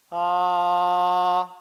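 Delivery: steady sung ah on one pitch. Shown as a demonstration. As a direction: hold a clear sung ah. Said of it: "si yo doy un tono de voz". (ah cantado sostenido)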